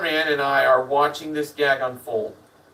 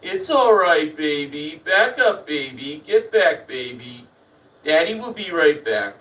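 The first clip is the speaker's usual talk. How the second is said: put-on baby talk. Man coaxes the chimp away from the door, imitated baby talk. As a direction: coax the animal back like a nervous daddy. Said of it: "The baby talk continues".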